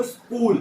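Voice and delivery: "स्कूल" with a long hissed s, then "kool"; breathed-out s. Shown as a demonstration. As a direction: stretch the s of "स्कूल" before the k. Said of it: "सांस बाहर निकालो". ("स्कूल" में लंबा स, फिर कूल)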